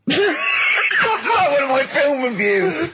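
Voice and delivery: high laugh through the words; barely getting it out for laughing; laughing at a friend's clumsy answer. Real, not acted.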